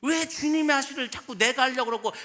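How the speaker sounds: ordinary talk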